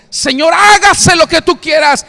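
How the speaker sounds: high, loud shouted surrender; Jesus surrendering cry. Acted. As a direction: cry it out to the Father in anguish. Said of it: "ya en la tercera vez le dijo". (Jesús gritando su entrega al Padre, agudo y fuerte)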